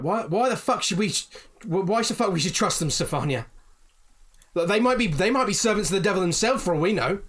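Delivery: high, loud, indignant ranting; angry outburst. Acted. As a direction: rail at the leader, distrustful and furious. Angry villager challenges the party.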